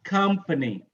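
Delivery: "Company" as COM-pa-ny, falling after COM; stress on first syllable. Shown as a demonstration. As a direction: hit COM in "Company", lighten the rest. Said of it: "the first one is stressed".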